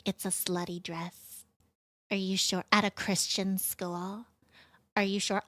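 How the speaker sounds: mock disapproving godmother. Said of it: "She was like".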